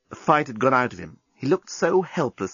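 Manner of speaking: ordinary delivery, nothing marked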